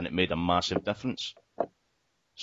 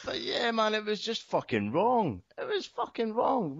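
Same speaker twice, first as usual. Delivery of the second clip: much higher, slow, disbelieving; exasperated; 13 semitones higher, slower than usual. Real, not acted. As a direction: drag it out in disbelief. Exasperated over the slogan.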